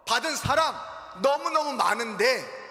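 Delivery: ordinary talk, nothing marked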